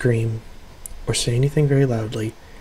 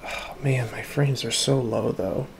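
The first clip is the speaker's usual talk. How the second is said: hushed; 12 dB quieter than usual. Real, not acted. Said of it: "I need to talk in a very low voice".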